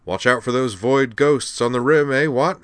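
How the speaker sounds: high, loud hearty toff farewell. A narrator's reading. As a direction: call it out loudly and jovially. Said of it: "in a louder tone".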